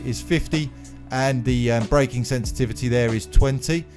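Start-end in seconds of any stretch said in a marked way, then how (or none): none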